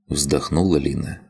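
usual speaking voice

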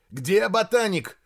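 high, loud, slowed shout; barked question. A narrator's reading. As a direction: demand it at a shout. Barking while searching camp. (громкий требовательный окрик)

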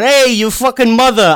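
hammed-up New York street greeting; New York accent. Showing an accent that's never welcoming, brash New York accent. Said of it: "sometimes a good Southern accent can be welcoming".

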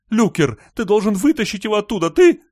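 shrieked, rising demand for help; frantic pleading. A narrator's reading. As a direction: plead loudly, pitch climbing into a shriek. Frantic Barbara begs Luker for rescue.

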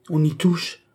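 high-pitched cry of mild anger; indignant exclamation. A narrator's reading. Son crying out at his father's remark, indignant. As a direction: echo it back in outrage. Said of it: "s'écria Philippe, rougissant à la fois de colère".

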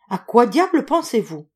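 loud, high, animated demand; exclaimed question. A narrator's reading. Visitor questioning his dreamy friend, exclaimed question. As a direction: press him with lively impatience.

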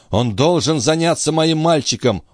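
high-pitched, pleading demand; stubborn insistence. A narrator's reading. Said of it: "упрямо настаивал старик". (упрямо настаивая, высоким голосом)

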